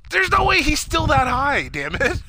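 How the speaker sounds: high, loud, drawn-out complaint; hammed-up indignation; exasperated protest. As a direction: protest it in mock outrage, slow and high. Acting out own exasperated reaction.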